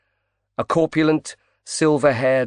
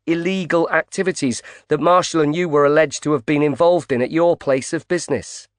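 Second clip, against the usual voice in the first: higher, brisk reporter pushing in; female reporter voice; 6 semitones higher, 4 dB louder than usual. Acted. Reporter Pope confronts grieving tycoon, pushy higher voice. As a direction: voice her pointed and eager.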